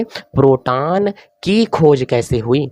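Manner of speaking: very slow, stretched-out words; slow dictation. Real, not acted. Teacher announces the next topic to write, slow dictation. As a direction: spell out the topic word by word.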